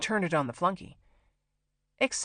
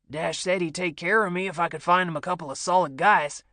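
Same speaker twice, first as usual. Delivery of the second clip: lightly voiced brash ex-soldier, a bit louder; cocky Bo; 4 dB louder than usual. Bo vouches for Will to Gerald, lightly voiced cocky swagger. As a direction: brag a little loud and swaggering. Bo's voice in phone call.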